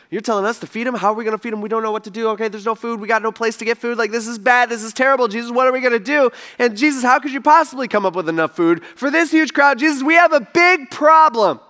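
mock panic. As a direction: build the freakout line by line until it peaks. Disciples panicking about feeding crowd.

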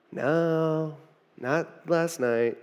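soft, slow, worn-out answer; barely bothering to answer after a long night; weary reply. Retold or imitated. Tired fishermen answering.

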